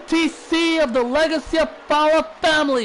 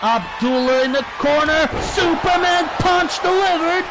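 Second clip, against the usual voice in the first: wrestling commentator; 4 semitones higher than usual. Real, not acted. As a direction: shout the big moves as they land. Live wrestling play-by-play climax.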